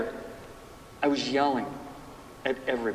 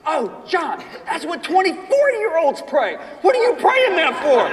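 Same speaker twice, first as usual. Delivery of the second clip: much higher, laughing incredulous shout; mock the foolish prayer while laughing; laughing friend; 12 semitones higher, 5 dB louder than usual. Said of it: "He said".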